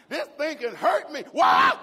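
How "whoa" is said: loud holler at a bolting horse; panicked yell. Retold or imitated. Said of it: "Think the more I yell".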